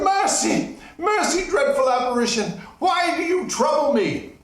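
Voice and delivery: high, loud pleading; begging for mercy. Acted. Scrooge on his knees begging the ghost for mercy. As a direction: plead on your knees in terror. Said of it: "Scrooge fell to his knees".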